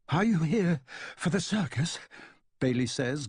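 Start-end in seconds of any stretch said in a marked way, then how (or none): 0.1-2.0 out of breath. Acted. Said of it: "gasping for breath".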